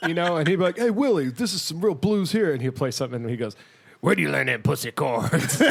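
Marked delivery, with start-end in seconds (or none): 0.8-2.5 put-on high-pitched, keen Jersey kid; eager Jersey guy. Jersey guy playing blues for his mentor, eager. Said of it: "he's like this Jersey guy". 4.0-5.6 lightly voiced scornful old bluesman. Old bluesman mocking the Jersey guy's chords, scornful. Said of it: "he plays [name]".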